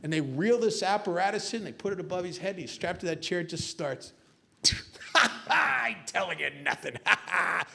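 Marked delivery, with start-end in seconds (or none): 4.6-7.7 hammed-up tough-guy cackle; forced, taunting ha-ha bravado; mock defiant laughing. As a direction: laugh in the torturer's face between words. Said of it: "And he's laughing. The guy says".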